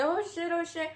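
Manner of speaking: a touch of mock alarm; panicked mock gasp. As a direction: act out sudden dread. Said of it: "And I was like".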